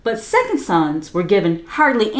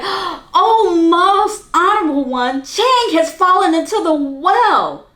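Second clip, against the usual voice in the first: high, loud urgent cry; raised voice, crying out; 9 semitones higher, 5 dB louder than usual. A narrator's reading. Older brother crying out to mother that Chang fell, loud and high. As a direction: call out in alarm, loud and high. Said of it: "raised his voice and cried".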